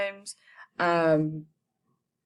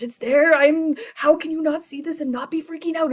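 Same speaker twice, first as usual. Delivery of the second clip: much higher and louder frantic pleading; panicked outburst; 11 semitones higher, 6 dB louder than usual. Retold or imitated. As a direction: yell it in disbelief and terror. Macbeth panics about the ghost.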